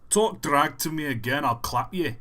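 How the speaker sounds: nose-blocked Glaswegian threat; Glasgow accent. Shown as a demonstration. Glaswegian threatening someone who talks back, nasal Glasgow accent. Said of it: "Kinda gotta block your nose a little bit".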